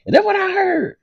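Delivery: very high squeal, half-crying; disbelieving squeal. Real, not acted. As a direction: squeal it in outrage. Shocked reaction to story.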